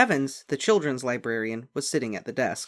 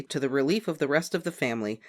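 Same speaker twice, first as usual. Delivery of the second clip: usual speaking voice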